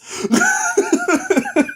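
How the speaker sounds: squealing laugh; high burst of laughter. Real, not acted. Reviewer laughs at the reporter's theory, high and squealing. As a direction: crack up at the absurd theory.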